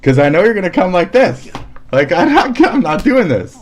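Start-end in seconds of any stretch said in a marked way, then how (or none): 1.9-3.6 laughing refusal. Real, not acted.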